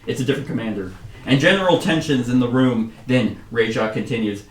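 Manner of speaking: ordinary talk, nothing marked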